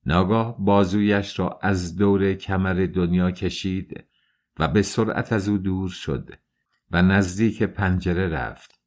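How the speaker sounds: usual speaking voice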